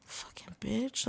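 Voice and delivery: very soft, slow mutter; muttered insult. Pretend. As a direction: grumble it under your breath. Muttered after call.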